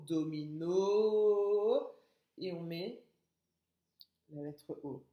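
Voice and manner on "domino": "domino" avec le o final très long, fort et montant; étirer la fin de "domino"; O final allongé. Shown as a demonstration. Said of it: "c'est le son". (« domino » au dernier o longuement tenu)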